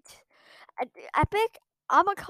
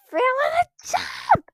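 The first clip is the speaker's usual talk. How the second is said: frustrated outburst; 12 semitones higher, 4 dB louder than usual. Real, not acted. Gamer complaining to viewers, exasperated high outburst. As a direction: pitch jumps much higher on the complaint.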